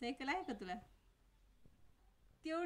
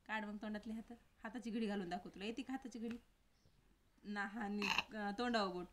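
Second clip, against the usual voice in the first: ordinary delivery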